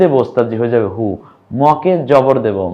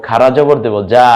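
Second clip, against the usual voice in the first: normal talking voice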